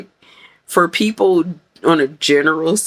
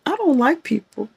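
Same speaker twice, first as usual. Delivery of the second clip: mock shell-shocked protest, high-pitched; 8 semitones higher than usual. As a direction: recoil from people, lightly hammed up. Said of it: "feel a little shell-shocked and say".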